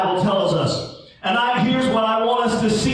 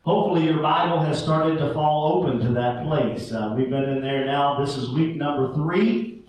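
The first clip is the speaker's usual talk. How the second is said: scripture reading; 8 semitones lower, 3 dB quieter than usual. Acted. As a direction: read the Bible passage to the congregation. Opening scripture reading, measured.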